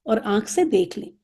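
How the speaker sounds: ordinary talk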